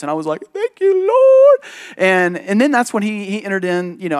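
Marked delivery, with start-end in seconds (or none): 0.5-1.6 "Thank you, Lord" drawn out in a high, loud, slow falsetto; hammed-up grateful cry, as if hearing angels; mock heavenly thanks. Retold grateful reaction, exaggerated.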